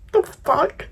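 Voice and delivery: laughing through tears in disbelief; a wet laugh at herself; tearful disbelief; teary laugh. Real, not acted. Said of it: "I'm crying".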